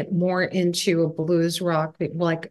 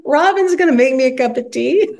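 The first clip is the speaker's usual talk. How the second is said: higher, louder mock astonishment; amazed reaction; 7 semitones higher, 7 dB louder than usual. Singer reacting to a legend offering tea, played-up amazement. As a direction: relive your disbelief, playing it up.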